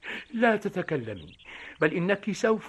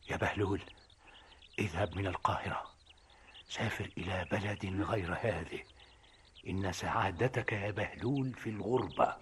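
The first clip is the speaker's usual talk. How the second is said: low, softer, eerie voice from a dream; booming dream voice; 7 semitones lower, 11 dB quieter than usual. Retold or imitated. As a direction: intone it like a ghostly voice in his sleep. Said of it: "صوت يهدر في أذني". (صوت الحلم الهادر بنبرة منخفضة غامضة)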